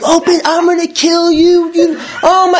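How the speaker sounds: 2 high raging scream breaking into a laugh; 3 lose it at the door, then laugh at yourself; 1 screaming threats. Retold or imitated.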